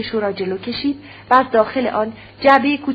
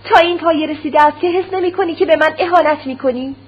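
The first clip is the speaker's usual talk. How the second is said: high, loud and enraged; wife finally bursting out at her husband; furious shout; 6 semitones higher, 5 dB louder than usual. Acted. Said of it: "با خشم تمام فریاد برآورد". (فریاد خشمگین و بلند)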